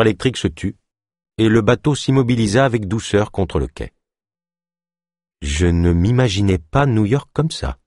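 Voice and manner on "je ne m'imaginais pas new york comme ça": ordinary delivery, nothing marked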